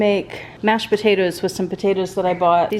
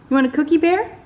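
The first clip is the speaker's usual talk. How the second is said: much higher sing-song offer of a treat; high coaxing pet voice; 8 semitones higher, faster than usual. Real, not acted. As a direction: lure them in with a high, eager voice. Coaxing the dogs with a cookie.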